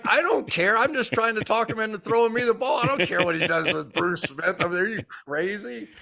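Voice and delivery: high, brushing-off reply; dismissive retort. Retold or imitated. Answering the teammate on the sideline, high and dismissive. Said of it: "I'm like".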